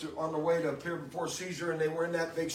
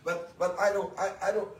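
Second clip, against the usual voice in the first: high, loud preaching at full force; fervent preaching; 5 semitones higher, 5 dB louder than usual. Acted. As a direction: preach it out to the congregation, building fervor. Preaching the helmet of salvation.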